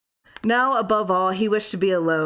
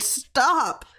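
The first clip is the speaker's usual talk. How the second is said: sharp, pained cry of "Stop!"; cried out; 7 semitones higher than usual. Acted. Husband covering his ears cries out at his wife's cracking knuckles. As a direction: cry it out, hands over ears. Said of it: "and cried".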